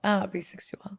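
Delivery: sultry whisper; hushed, voiceless echo of a word. Acted. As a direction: whisper it softly, a little sultry. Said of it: "Do you have to whisper it too?".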